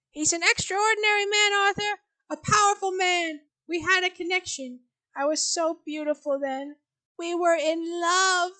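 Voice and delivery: put-on high, slow old mother lost in memory; dreamy fond remembering; wistful old mother.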